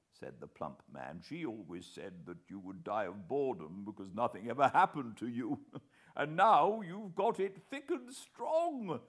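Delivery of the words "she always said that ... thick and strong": enjoy the joke on uncle; pleasant laugh. A narrator's reading. Plump man teases uncle, laughing pleasantly. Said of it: "began to laugh very pleasantly".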